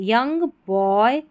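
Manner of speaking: ordinary delivery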